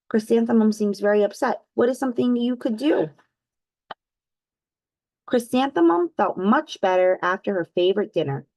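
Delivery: usual speaking voice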